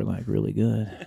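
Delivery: low, slow public radio voice; slow right down, low and close to the mic; NPR voice. Acted. Host reviewing his dates as if on NPR, radio voice. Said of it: "like you're on N P R".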